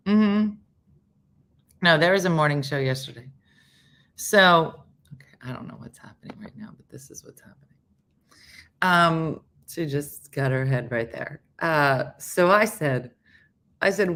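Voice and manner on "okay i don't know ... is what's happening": fast hushed whisper. Real, not acted. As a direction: whisper it quickly aside. Aside about the pet.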